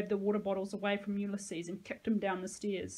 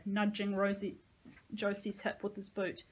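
plain reading voice

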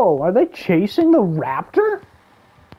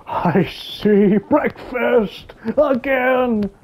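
greedy dinosaur voice; 3 semitones higher, much slower than usual. Pretend. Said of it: "he's like".

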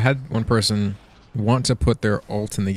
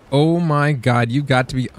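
normal talking voice

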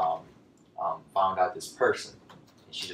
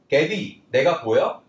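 loud, raised call to a friend; calling on phone; 7 semitones higher, 9 dB louder, faster than usual. Acted. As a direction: call out higher and louder to be spotted. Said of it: "talking to her on the phone".

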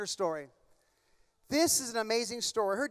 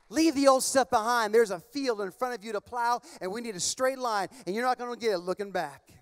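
ordinary delivery

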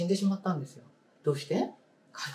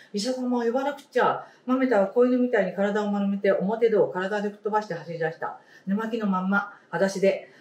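story reading; 4 semitones higher than usual. Acted. Teacher reading a picture book to the class, storybook voice. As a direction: clear storybook read-aloud voice.

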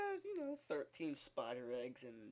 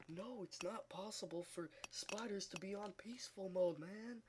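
whispered mock of his friend; breathy whispered imitation of Daniel; 5 semitones higher, 4 dB quieter than usual. Quoting his doubting friend, a mocking whisper. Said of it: "And he said".

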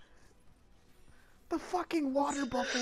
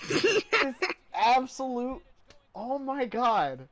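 ordinary delivery

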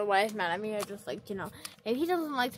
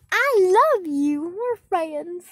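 loud, high, drawn-out cooing; gushing affection; 6 semitones higher, 11 dB louder, much slower than usual. Pretend. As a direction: gush over a pet at full volume. Gushing at the annoyed cat.

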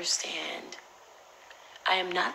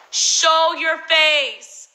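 high, slow, drawn-out bellow; demanding yell, each word stretched; shouted challenge; 9 semitones higher, 11 dB louder, much slower than usual. Retold or imitated. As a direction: call out the hidden culprit at full volume. Calling out the unknown note writer.

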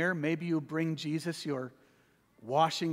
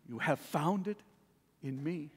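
soft echo; 3 semitones lower, 6 dB quieter than usual. Acted. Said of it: "whispering into your ears".

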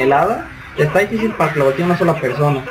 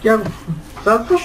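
panicked shout; 5 semitones higher than usual. Real, not acted. Panicking in game.

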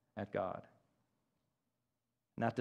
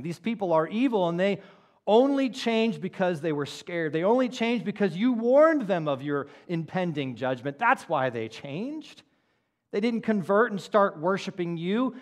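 mock indignant Jonah, somewhat higher and louder; Jonah's complaint; 5 semitones higher, 5 dB louder than usual. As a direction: retell a prophet's grievance with a touch of heat. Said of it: "he's saying".

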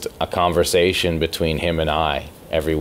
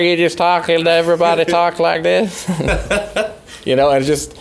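high-pitched, loud, fast mock Floridian twang; mock Florida accent, much higher, louder and faster; 12 semitones higher, 5 dB louder than usual. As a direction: lampoon how people in Florida talk, way up high.

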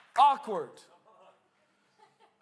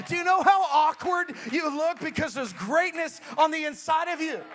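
louder, higher, teasing blunt question; mock blunt call-out; 4 semitones higher, 6 dB louder than usual. Preacher teases an awkward youth, loud and playful. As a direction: tease the kid then lift him up.

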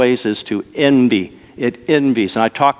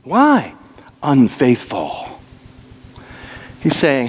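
ordinary delivery

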